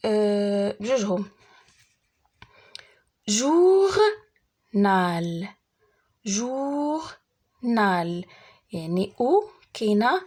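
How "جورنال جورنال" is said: "جورنال" slowly as long jour, pause, nal; "جورنال" sounded out in two parts; split into syllables. Shown as a demonstration. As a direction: stretch the first syllable of "جورنال", then pause. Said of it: "في لا سيلاب الاولى".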